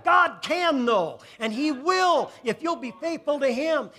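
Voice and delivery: high, loud, driving preacher's cry; preaching at full force. Acted. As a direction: shout the blessing over the congregation.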